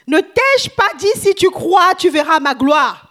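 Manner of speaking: booming scripture voice; loud proclamation. Acted. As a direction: declare it like Jesus rebuking. Preacher proclaims Jesus' words.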